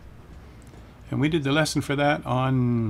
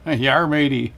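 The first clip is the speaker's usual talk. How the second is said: pirate growl broken by laughs, louder; laughing pirate voice; 3 semitones higher, 7 dB louder, slower than usual. Pretend. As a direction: laugh through a hammy pirate voice. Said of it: "You sound like a pirate".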